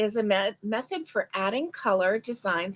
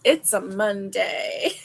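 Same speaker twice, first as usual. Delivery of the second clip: words through real laughter; laughing while saying it. Real, not acted. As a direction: giggle it out, slower. Laughing at her own joke.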